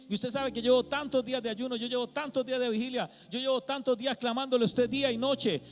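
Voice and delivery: high, loud, building plea to God; pleading prayer cry. Retold or imitated. Retold prayer, crying out.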